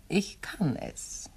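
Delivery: slow, soft, pointed; quiet meaningful pause on kann; quiet emphasis. Acted. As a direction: let it sink in slowly. Pointed hint to Parker.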